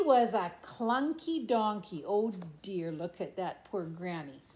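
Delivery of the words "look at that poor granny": ordinary delivery, nothing marked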